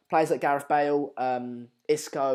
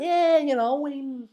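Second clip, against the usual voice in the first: playful exaggerated Scouse lilt; Scouse accent; 9 semitones higher than usual. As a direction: put on Scouse, pitched well up. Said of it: "quite a good Scouse accent".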